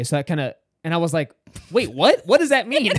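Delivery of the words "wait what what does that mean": loud, high-pitched double take; mock-alarmed questioning; startled disbelief. Guest reacts to host's ominous hint, startled disbelief.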